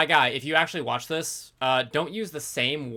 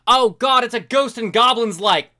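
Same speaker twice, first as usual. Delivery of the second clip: louder, higher burst of recognition; oh god, louder and higher; excited outburst; 6 semitones higher, 6 dB louder than usual. Real, not acted. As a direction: blurt it out in alarm. Reacting loudly to the text.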